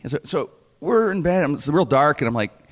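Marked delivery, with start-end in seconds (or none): none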